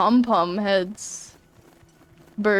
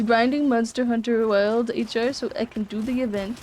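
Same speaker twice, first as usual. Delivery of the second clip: ordinary talk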